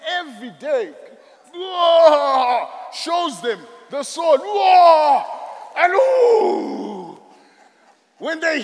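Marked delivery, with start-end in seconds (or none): 1.5-2.7 loud whoa. Acted. Goliath showing sword, crowd reacts. 4.4-7.2 loud gasping whoa. Acted. Crowd gasps at the giant.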